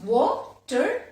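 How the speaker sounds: "watermelon" with pauses as wa, ter, me, lon; syllable by syllable. Shown as a demonstration. Teacher repeats the second example, syllable by syllable. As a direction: sound out "watermelon" in four beats. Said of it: "Four syllables".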